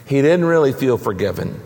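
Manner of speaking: ordinary talk, nothing marked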